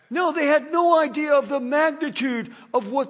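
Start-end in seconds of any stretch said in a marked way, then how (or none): none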